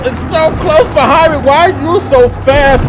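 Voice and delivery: ordinary delivery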